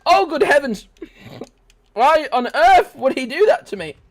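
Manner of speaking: high, loud startled toff; a posh gentleman's startled cry; posh shriek. Acted. Gamer shrieking like a startled posh gentleman.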